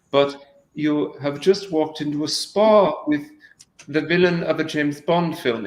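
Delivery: put-on menacingly polite Bond villain with a European accent; vague continental accent. Exiled Romanian count in a spa, vague continental accent. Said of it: "just a continental accent".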